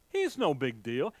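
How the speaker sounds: ordinary talk, nothing marked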